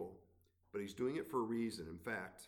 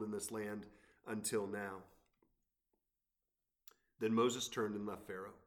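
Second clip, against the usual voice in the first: lifted, measured Bible reading; scripture reading; 2 semitones higher, 7 dB louder than usual. Acted. Pastor reads Exodus to the congregation, raised reading voice. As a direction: read God's words to Pharaoh with weight.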